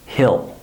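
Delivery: HILL with a short lax I; reduced contraction. Shown as a demonstration. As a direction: say HILL like the word H-I-L-L. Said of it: "I use an I sound".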